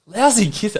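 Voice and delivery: mock repeat. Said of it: "Lousy kisser? Could you say that to someone?".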